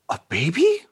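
high gasping question; wide-eyed surprise. Acted. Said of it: "his eyes go wide".

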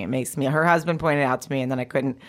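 ordinary talk, nothing marked

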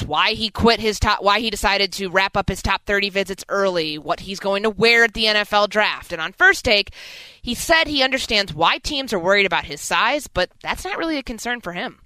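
ordinary talk

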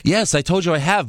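usual speaking voice

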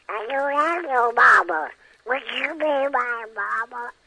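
very high, slow cartoon duckling voice; Yankee Doodle, sadly. Shown as a demonstration. Orphan duck asking bulldog to be his mama, sad squeaky voice. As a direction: plead like a forlorn, squeaky little duck. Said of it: "he always answered sadly".

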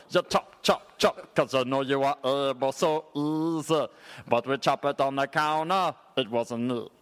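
mock Jamaican dancehall sales pitch; sing-song reggae toaster selling a kitchen gadget. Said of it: "How would Shaggy sell a slap chop".

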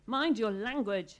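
slow, loud rebuke; thundering. A narrator's reading. Father rebuking his children, slow and loud. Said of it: "his father thundered".

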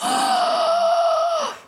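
long, high gasp; shocked gasp. Real, not acted. Co-host hears he left her, shocked gasp. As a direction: suck in a big dramatic gasp. Reacting to being left by letter.

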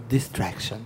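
Distraction said low and very softly, with relish; stylized intonation. Real, not acted. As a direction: murmur the title Distraction like a smooth late-night radio host. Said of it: "eu gostei da entonação". (entonação caprichada em Distraction, grave e baixinha)

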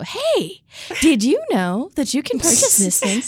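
high "hey", then exaggerated sing-song question; mid-roll ad parody. Host showing how their mid-roll ads go, mock pitch. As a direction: spoof a chirpy ad opener. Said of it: "how a lot of our mid-roll ads go".

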